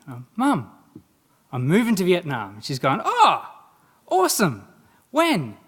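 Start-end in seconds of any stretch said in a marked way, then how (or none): 0.3-2.6 excited announcement. Retold or imitated. Telling Mum big news. 3.0-5.6 high, loud, excited mum's delighted reply. Retold or imitated. Mum thrilled at son's news, high and loud.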